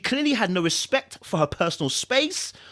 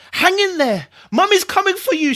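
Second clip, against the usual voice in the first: higher, louder desperate call; urgent call; 12 semitones higher, 7 dB louder than usual. Acted. Mother calling to her trapped children, urgent and loud. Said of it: "she prayed".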